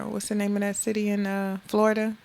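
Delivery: ordinary talk, nothing marked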